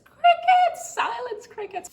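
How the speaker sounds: exasperated shout. Real, not acted. Grad applicant venting about an unanswered email, exasperated shout. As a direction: throw it out in disbelief. No reply from the university.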